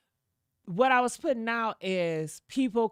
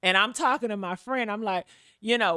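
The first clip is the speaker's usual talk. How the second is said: normal talking voice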